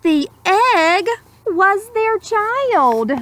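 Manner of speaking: put-on slow, lilting read-aloud to children; gentle rhyming storyteller; storybook narration. A narrator's reading. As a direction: savour each word like a bedtime story. Rhyming picture-book narration.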